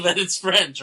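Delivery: ordinary talk, nothing marked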